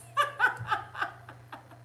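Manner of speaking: high, breath-filled run of laughter; cackling laugh. Real, not acted. Host cracking up at her bananas line, long laugh.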